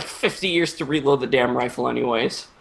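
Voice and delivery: ordinary talk, nothing marked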